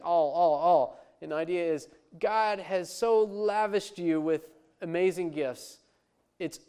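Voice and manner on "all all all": louder, punched "All, all, all"; mock repeated all. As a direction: hammer "all" three times. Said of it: "he's like".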